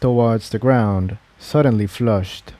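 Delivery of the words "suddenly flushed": usual speaking voice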